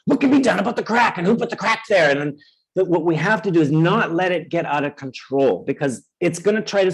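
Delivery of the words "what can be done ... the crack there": high, alarmed, fussing over every crack; panicky fretting. Pretend. The anxious self pointing out cracks in the wall, alarmed and high. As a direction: fret like a worried inspector finding flaws. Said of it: "and say".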